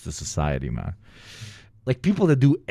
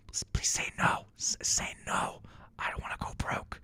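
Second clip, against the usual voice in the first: whispered plea; 14 dB quieter than usual. Real, not acted. Streamer hoping the donor declines the gamble, whispered plea. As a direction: beg under your breath so nobody hears. Pleading aside during gamble.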